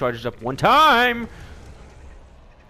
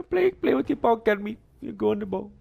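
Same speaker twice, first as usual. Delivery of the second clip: ordinary delivery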